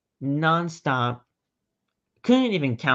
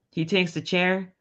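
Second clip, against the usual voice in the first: ordinary talk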